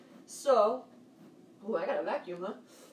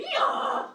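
donkey bray; 6 dB louder than usual. Shown as a demonstration. Donkey stampede game with kids.

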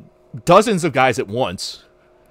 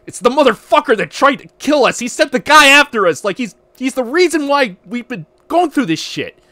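angry accusation; 9 semitones higher, 5 dB louder than usual. Retold or imitated. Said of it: "he's like".

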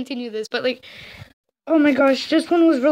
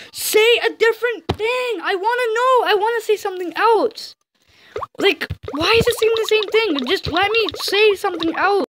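exasperated, pitched-up complaint; exasperated pleading; 6 semitones higher than usual. Real, not acted. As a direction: plead with the game in a high, frustrated voice. Frustrated pleading with the repeating game.

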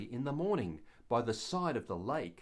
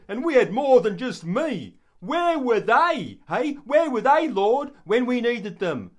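much higher, louder, outraged tirade; indignant rant; 7 semitones higher, 10 dB louder than usual. Acted. Peter fuming at being told to feed the disciples, indignant rant. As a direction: vent your resentment at the others. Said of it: "I imagine he thought this".

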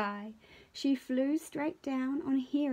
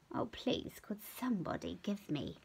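much softer, breathy pleading; faint plea; 8 dB quieter than usual. Acted. Hare pleads faintly for a scratch, softly. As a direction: whisper the plea.

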